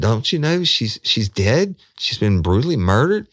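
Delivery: high, loud, panicked questions; freaked-out woman. Retold or imitated. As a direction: voice a scared woman confronting him. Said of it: "It freaked me the fuck out".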